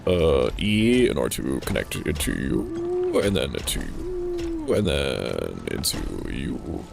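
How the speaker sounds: sing-song voice. Pretend. Singsong talking to machines.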